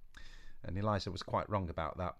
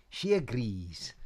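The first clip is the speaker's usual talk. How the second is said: put-on drawn-out sly old witch; sly witch, slow; 3 dB louder, slower than usual. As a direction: twist the answer slowly. Boris voiced.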